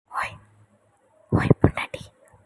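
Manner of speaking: breathy night-time whisper to a sleeping wife; hushed call in the dark. Acted. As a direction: whisper her awake gently. Husband waking wife at night.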